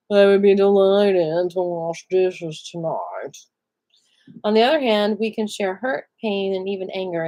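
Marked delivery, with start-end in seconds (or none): 0.1-3.4 slow, loud, hammed-up snarl. Shown as a demonstration. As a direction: snarl it out slowly, dragging every word. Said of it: "said in a snarling tone".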